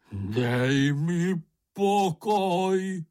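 high, long-drawn hysterical wail; hysterical cry. A narrator's reading. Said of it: "vykřikl Bambul hystericky". (hystericky, táhle vykřiknout)

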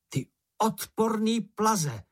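high, drawn-out storybook taunt; hollering insults. A narrator's reading. Mumínek provokes the poisonous bush, hollered insults. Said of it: "zahulákal Mumínek".

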